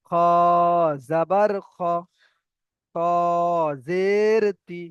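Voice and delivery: held "খ" and "ত", short final syllables; spelling demonstration. Shown as a demonstration. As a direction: stretch each letter name slowly. Teacher models letter-by-letter spelling.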